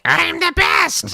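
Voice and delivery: squeaky high Toad shout; very high, slow cartoon cry. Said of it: "Toad's voice in it, which wasn't all like".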